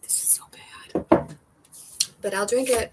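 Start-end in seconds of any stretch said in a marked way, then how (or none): 0.0-1.3 whispered aside. Real, not acted. Tasting a bad drink.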